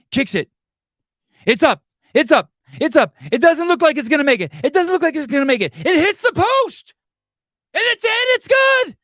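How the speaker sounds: rising to a high, loud shout; excited commentator call. Shown as a demonstration. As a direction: scream it like the kick is live. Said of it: "shows how to call the kick".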